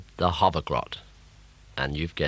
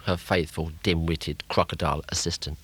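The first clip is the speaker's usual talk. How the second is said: usual speaking voice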